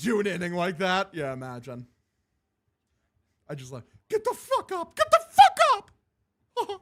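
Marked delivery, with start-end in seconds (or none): 4.1-6.8 high squeak exploding into a yell; Mickey Mouse. Retold or imitated. Streamer replays Mickey's outburst, rising to high screaming. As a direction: build to a furious mouse-falsetto shout. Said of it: "Legit South Park Mickey Mouse".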